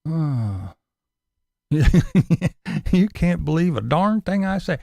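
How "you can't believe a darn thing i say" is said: laughing. Retold or imitated. Laughing mock of Tim.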